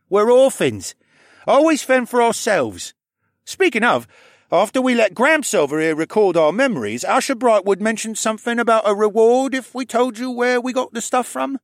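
put-on high, loud streetwise kid; cocky boy voice. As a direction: talk like a cheeky orphan. Viken speaks as streetwise kid.

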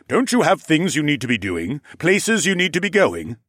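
pushy, rising questions; impatient. Acted. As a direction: hurry him out the door. Shooing the guest out.

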